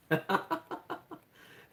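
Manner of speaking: loud, high burst of laughter; high cackling laugh, really amused. Real, not acted. Cuber cracking up at their own replay, high laugh.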